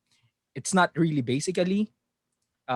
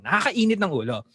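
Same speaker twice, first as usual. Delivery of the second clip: usual speaking voice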